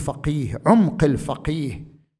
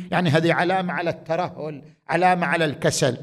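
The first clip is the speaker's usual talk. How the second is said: ordinary talk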